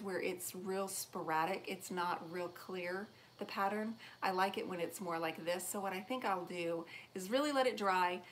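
normal talking voice

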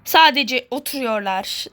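normal talking voice

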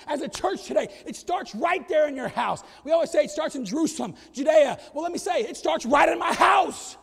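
loud rising preaching; preaching at full force. Acted. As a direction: pastor urging church to start at home. Preaching builds to shout.

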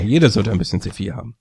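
usual speaking voice

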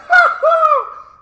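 loud scornful burst of laughter. Real, not acted. Mocking laugh at insult.

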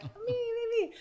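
high eager me me me; eager fans. Retold or imitated. Audience girls begging to come on stage, high eager squeal. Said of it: "they're all like".

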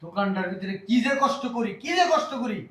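high, rising frustrated rant; exasperated complaint. Acted.

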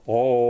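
low, held-out OH; open vowel demo. Shown as a demonstration. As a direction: draw out a round, open "Oh". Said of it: "Inside your mouth".

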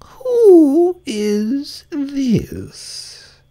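very high, very slow imperious voice; Queen of Hearts interrogating Alice; severe Queen. Acted. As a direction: drag out the demand severely. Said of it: "the Queen said severely".

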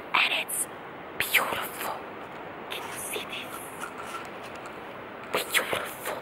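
hushed whisper in awe. Real, not acted. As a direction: breathe it softly in wonder. Whispering at the view.

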